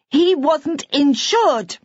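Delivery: slow, high, mock-horrified child voice, not whispered; a small girl copying her mother's alarm. A narrator's reading. Little girl parroting mother's horror to the grown-ups. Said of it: "This came in a horrified whisper".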